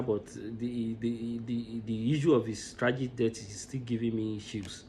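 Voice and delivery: quietly fighting tears; holding back emotion. Real, not acted. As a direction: voice sinking low and soft, choked up. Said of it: "I don't want to get emotional".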